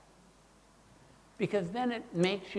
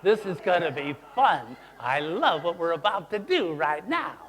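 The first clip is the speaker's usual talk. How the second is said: gleeful mischief; 5 dB louder than usual. Retold or imitated. Cat child delights in conflict.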